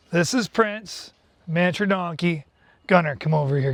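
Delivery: ordinary delivery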